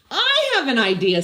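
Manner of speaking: eager idea. Acted. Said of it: "said Desmond".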